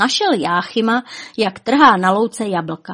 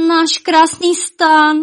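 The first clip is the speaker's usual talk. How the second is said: high, loud, drawn-out wail; tearful lament; 8 semitones higher, 7 dB louder, slower than usual. A narrator's reading. Elves returning to their wrecked tent, tearful lament. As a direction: wail slowly over the loss, high-pitched.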